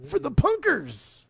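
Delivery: high-pitched, drawn-out proclamation; mock rallying cry. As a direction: call it out slowly, way up high.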